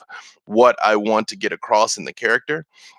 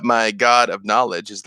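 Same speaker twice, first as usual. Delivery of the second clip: ordinary talk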